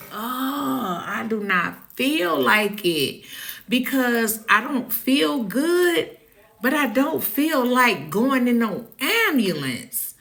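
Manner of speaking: whiny refusal. Retold or imitated.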